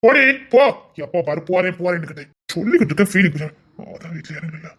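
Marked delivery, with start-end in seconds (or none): 0.0-0.6 loud retort. Acted. Husband snapping at wife.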